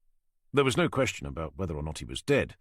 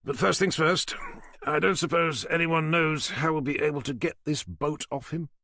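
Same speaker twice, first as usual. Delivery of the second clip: a higher, dry, sardonic doctor; wry doctor voice; 9 semitones higher, 3 dB louder than usual. Acted. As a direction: pathologist hedging his promises with irony. Doctor character voice.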